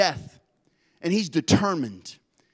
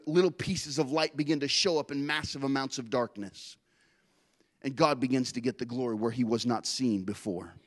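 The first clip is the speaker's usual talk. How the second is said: ordinary talk, nothing marked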